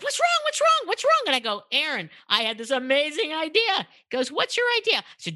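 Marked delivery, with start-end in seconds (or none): 0.0-1.2 very high, rapid-fire mock panic; imitated alarm. Acting out partner's alarmed reaction. 1.7-3.9 higher-pitched eager announcement; retold excitement. Retelling her excited announcement. 4.3-5.0 very high, mock-urgent asking; imitated eager question. Acting out partner's eager question.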